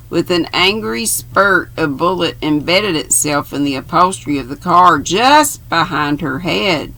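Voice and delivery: ordinary delivery, nothing marked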